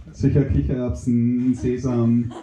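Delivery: very low, dopey mushroom voice. Acted. Mushroom mishears hummus.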